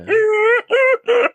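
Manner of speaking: high, loud imitated honking laugh; Eddie Murphy. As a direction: force out a mock movie laugh. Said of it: "That's just his laugh from Beverly Hills Cop".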